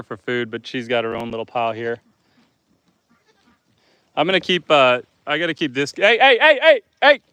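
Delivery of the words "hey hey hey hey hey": high, loud sharp shouts; shouting hey. Real, not acted. Owner shouting to drive animals off, sharp loud shouts. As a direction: shoo them off fast.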